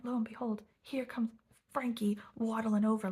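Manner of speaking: usual speaking voice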